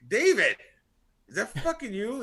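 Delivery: high, loud surprised call to someone he recognizes; startled shout. Retold or imitated. Recognizing his cousin stepping out of the Escalade, loud high shout.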